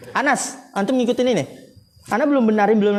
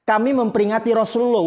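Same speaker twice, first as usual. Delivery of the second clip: usual speaking voice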